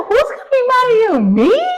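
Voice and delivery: high, loud disbelief, fast; a mother's loud, high-pitched disbelief; incredulous. Retold or imitated. Mother's incredulous reply to son.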